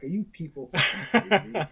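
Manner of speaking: retold disbelief, rising high and loud; incredulous outburst. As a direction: blurt it out in mock outrage, punching the swear word. Retold disbelief at audition notice.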